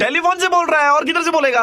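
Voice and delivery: very high, loud cheeky retort; put-on shouting. Prank caller answering where he's calling from, shouted sarcasm. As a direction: yell back in mock indignation.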